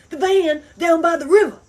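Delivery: loud, high, booming shouted impression; shouting comedy-sketch catchphrase. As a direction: bellow it like a frantic sketch character. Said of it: "The heavy guy who was like".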